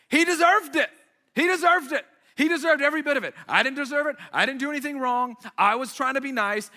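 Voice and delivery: high, loud, insistent protest; worked-up vindication. Real, not acted. Storyteller insists the milkshake thrower deserved it, high excited protest. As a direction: hammer it out, high and righteous.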